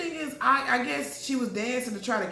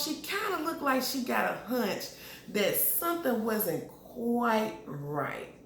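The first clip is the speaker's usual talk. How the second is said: normal talking voice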